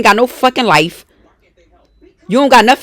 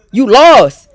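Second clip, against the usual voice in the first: loud jab; 6 dB louder than usual. Real, not acted. Ranting at the rival.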